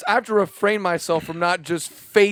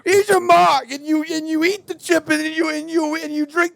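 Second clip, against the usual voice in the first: mock waiter; 9 semitones higher, 5 dB louder than usual. Replaying the waiter bit.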